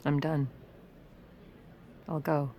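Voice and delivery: defeated resignation. Acted. Edith concedes defeat.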